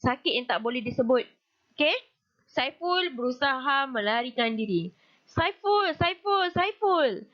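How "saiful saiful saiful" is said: calling a name. Acted. Dream voice calls the boy.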